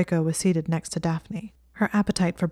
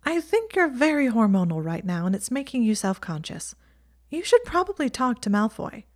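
lightly raised, frank friend; Ginny advising; 6 semitones higher than usual. A narrator's reading. Ginny telling Hermione to talk to Malfoy, frank and lightly raised. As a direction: give blunt advice kindly.

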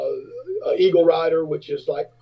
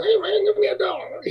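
very high squeaky plea from the crowd; distant midget voice. Retold or imitated. To the bass player in the crowd, very high squeaky begging. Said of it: "I hear him going".

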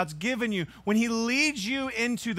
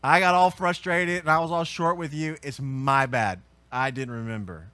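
sincere apology; 5 semitones lower than usual. Retold or imitated. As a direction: own up gently to your daughter. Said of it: "repent to my daughter and say".

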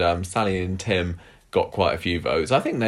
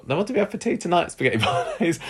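mock Welsh Gemma; 11 semitones higher, faster than usual. Voicing Gemma, Welsh accents talk.

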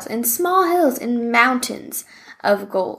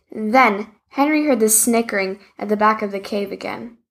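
ordinary delivery

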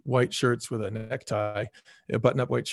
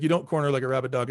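ordinary delivery

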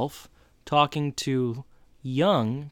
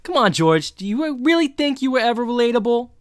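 higher, louder mocking teacher voice; Turner impression; 10 semitones higher, 6 dB louder than usual. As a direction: needle George loudly and a bit higher. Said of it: "That wasn't a very good Turner accent either".

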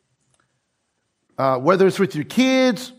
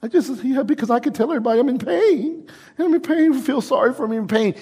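higher, whiny mock complaint; mock self-pity; 7 semitones higher, faster than usual. Preacher mocking churchgoers who enjoy pain, whiny and higher. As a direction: ham up a self-pitying moaner.

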